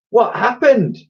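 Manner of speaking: put-on high, loud boy's call. A narrator's reading. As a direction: call down to people below. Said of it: "Ned called out from above".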